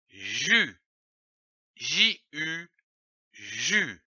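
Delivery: slow teacher syllable drill, letters spelled between; syllable sounded out. Shown as a demonstration. Teacher sounding out ja for a child. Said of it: "Je lis une syllabe".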